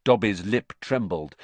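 usual speaking voice

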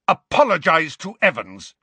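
high, loud roar. Acted. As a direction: bellow the order. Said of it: "James roared at Snape".

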